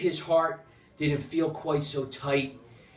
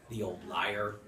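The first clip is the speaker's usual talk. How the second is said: ordinary delivery, nothing marked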